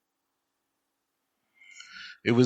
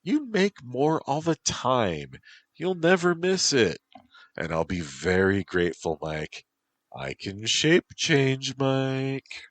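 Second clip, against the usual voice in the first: Whisper; 9 semitones higher than usual. Acted.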